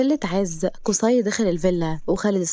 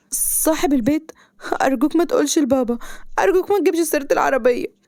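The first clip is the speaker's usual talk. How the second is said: high, anxious begging; panicked pleading; 7 semitones higher than usual. A narrator's reading. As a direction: plead urgently as if found out. Panics, begs him not to tell. (بترجي وخوف)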